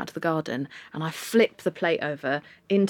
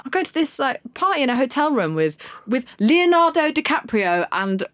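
bright, much higher mock friendly hello; high chirpy greeting; 6 semitones higher than usual. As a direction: pitch the old acquaintance's surprised hello up high. Bellamy's invitation retold.

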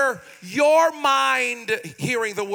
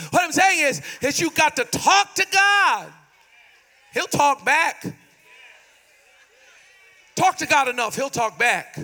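raised, higher-pitched preaching cry; forceful preaching; 4 semitones higher, 3 dB louder than usual. Real, not acted. Preacher telling congregation to talk to God, forceful preaching. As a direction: press the point home in a high preaching voice.

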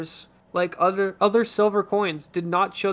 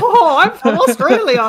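high, loud hammed-up Australian accent, laughing; 9 semitones higher, 11 dB louder than usual. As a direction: play a cartoon Aussie. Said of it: "That was a great Australian accent".

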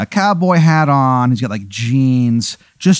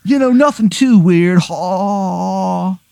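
higher, sly surfer with a short laugh; surfer dude voice; 8 semitones higher, slower than usual. Acted. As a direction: brush it off with a creepy chuckle. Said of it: "He goes".

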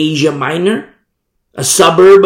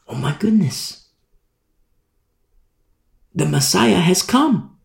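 growing excitement; 4 semitones higher than usual. Acted. Synagogue listener realizing the Messiah came, thrilled. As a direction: build to amazed joy.

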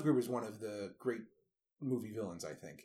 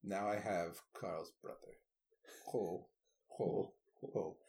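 villain quote, deadpan; 6 semitones lower, 8 dB quieter, slower than usual. Retold or imitated. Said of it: "he becomes Santa Claus".

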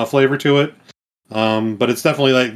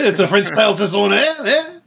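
French accent pushed higher, hammed up; 9 semitones higher than usual. Guest shows off his French accent on the beer name, hammed up. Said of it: "my accent is probably horrible".

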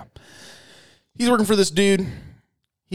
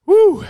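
high loud "Woo!" after scalding coffee; loud whoop; 8 dB louder than usual. Real, not acted. Host whoops after sipping hot coffee.

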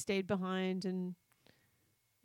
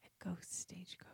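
voiceless spooky whisper; ghostly whisper; 14 dB quieter than usual. Acted. Cohost adding to the ghost story in a spooky whisper. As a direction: whisper it like a campfire tale.

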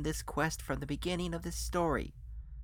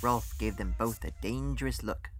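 ordinary delivery

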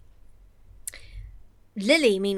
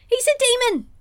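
high, loud exclamation; excited; 8 dB louder, much faster than usual. Real, not acted. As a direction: shout it out in mock outrage. Exclaims about the character.

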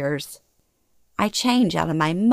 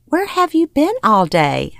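higher-pitched, insistent mother voice; mother's demanding question; 7 semitones higher, 4 dB louder than usual. A narrator's reading. Mother questioning daughter back at the house, insistent. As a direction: ask it as a put-out mother.

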